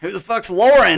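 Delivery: loud mock confusion; incredulous yell. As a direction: shout it like you can't believe it.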